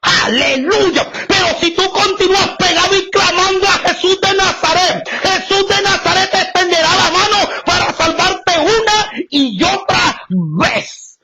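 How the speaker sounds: shouted high preacher cadence with tearful aleluyas; fiery preaching. Acted. Preacher crying out to the congregation, fervent shout. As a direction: preach it out with full fervour.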